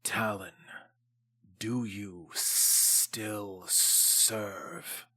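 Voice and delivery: low, slow, hushed and drawn-out; slow creature voice. Acted. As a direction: speak like a huge old beast weighing every word. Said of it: "fixes her with a stare and says".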